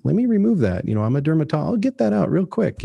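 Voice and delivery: high, breezy pitch of a doctor eager to cut; a cheery surgeon promising a quick fix, well up in pitch; mock dermatologist. Consultant teasing clients with old mainframes, speaking as a chirpy high-pitched dermatologist.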